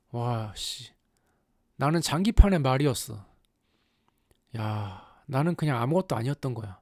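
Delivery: low, subdued muttered lament; deflated hero talking to himself; acted dejected realization. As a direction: voice drops as the disillusionment sinks in. Pilot realizes he was a pawn.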